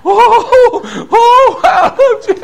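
high, loud, tearful wail of the hymn line; sobbing hymn. Retold or imitated. Preacher reenacting himself weeping the hymn. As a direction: cry the words out through tears. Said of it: "I was crying, just going".

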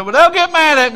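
high loud whiny mock excuse. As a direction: mimic the timid church member. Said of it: "You say".

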